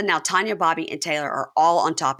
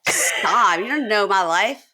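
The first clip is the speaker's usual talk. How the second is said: mock drunk protest; 4 semitones higher, 3 dB louder, slower than usual. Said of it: "He's like".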